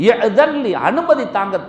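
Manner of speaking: high, loud retold demand; boiling anger. Umar asking leave to punish the man, retold in loud anger. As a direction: burst in hot-headed, pitch and volume up. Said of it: "அவளுக்கு கோபம் கொப்பளிக்க ஆரம்பிச்சுட்டு".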